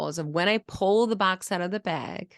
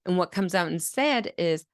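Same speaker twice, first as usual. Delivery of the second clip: ordinary talk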